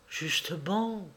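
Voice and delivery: soft, slow, weighted word; grave hush. Acted. Woman confirming the worst, slow and hushed. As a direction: let it fall heavily and quietly.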